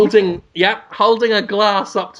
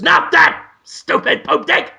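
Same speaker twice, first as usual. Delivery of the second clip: high, rasped cartoon cat tantrum; hammed-up bossy cat, pitched high; Willem Dafoe; 8 semitones higher, slower than usual. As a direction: demand it slowly like a furious cat. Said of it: "and he goes".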